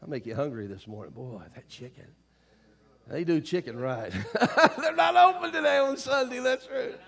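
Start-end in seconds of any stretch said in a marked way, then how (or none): 4.4-7.0 high, laughing aside. Real, not acted. Preacher joking about a Sunday closing, laughing. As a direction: say it through a real laugh.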